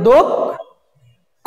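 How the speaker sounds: দক্ষ very slowly as "dok... kho"; long gap inside দক্ষ after "dok"; slow split syllables. Shown as a demonstration. Teacher telling class to notice, দক্ষ split slowly "dok... kho". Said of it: "একটু খেয়াল করো".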